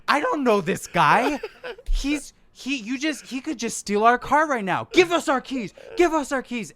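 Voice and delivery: high, loud, insistent yelling; drunk demand. Retold or imitated. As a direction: demand the keys like a paranoid drunk. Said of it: "you're like".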